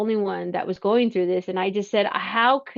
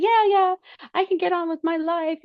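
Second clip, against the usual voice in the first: relieved; 8 semitones higher than usual. Retold or imitated. Relief at being left alone.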